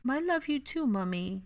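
put-on high little boy's voice, tender; a toddler saying it back to his mother; small child, softly. A narrator's reading. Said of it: "Aurelian told her just as softly".

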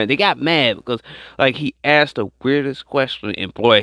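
ordinary delivery

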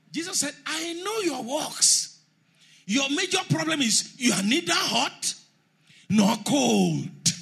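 high, loud, slow scripture declaration; preaching at full force. Real, not acted. Preacher warning the congregation at full force, high and loud. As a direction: proclaim it like a thunderclap.

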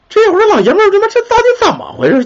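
sudden high-pitched disbelief; baffled outburst. Retold or imitated. Husband bewildered by the scattered ashes, sudden high-pitched outcry. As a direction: jump way up in pitch, utterly bewildered.

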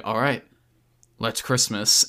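lightly voiced Cage, quiet and low; Nicolas Cage line. Cage nodding to the crew, low and quietly decided. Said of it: "He's like".

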